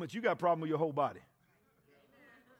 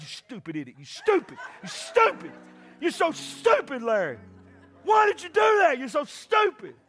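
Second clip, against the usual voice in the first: hammed-up angry scolding of yourself, mostly loud; mock self-scolding; 6 semitones higher, 5 dB louder than usual. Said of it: "you be like".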